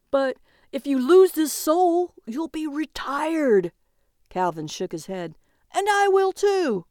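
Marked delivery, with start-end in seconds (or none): 0.1-3.7 high-pitched, anxious warning; alarmed warning. A narrator's reading. Calvin warns his partner about losing, alarmed. 5.7-6.9 very high, loud protest; panicked. A narrator's reading. Calvin fears being retired too, panicked.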